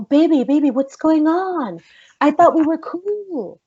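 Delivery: slow, high, drawn-out mock pleading; wheedling man. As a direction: imitate a man cajoling with stretched-out words. Imitates Josh smooth-talking her.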